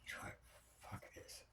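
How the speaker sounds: soft unvoiced muttered curse; whispered curse. Fed-up husband giving up on waiting, whispered. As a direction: let it slip under your breath.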